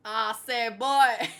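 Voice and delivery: loud mock Texan catchphrase; hammed-up Texan voice, punching the last word; rough Texas accent. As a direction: boom it out in a put-on Texas accent. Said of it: "only thing I can kind of get right".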